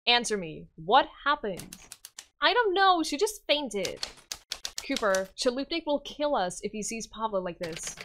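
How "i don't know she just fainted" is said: put-on higher, haughty child voice, smug; a spoiled little king showing off; bratty boy king. Yuri boasting to Silver, higher smug child voice.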